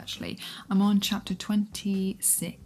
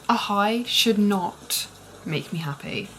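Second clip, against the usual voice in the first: normal talking voice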